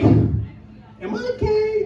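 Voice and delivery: very high, very slow panicked squeak; panicked self-question. Retold or imitated. Guy after a weird night asks himself, high slow squeak. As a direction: squeak it out like a freaked-out friend. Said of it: "and you're like".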